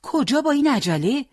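a high, startled question; surprised nurse. A narrator's reading. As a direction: catch them sneaking out, surprised. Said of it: "با تعجب پرسید".

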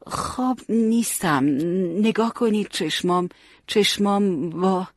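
slow, high, dragged drowsy protest; halting, sleepy. A narrator's reading. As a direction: struggle to sound awake, word by word. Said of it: "بریده بریده گفت".

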